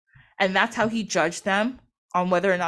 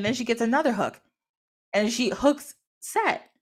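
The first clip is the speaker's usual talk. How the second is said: normal talking voice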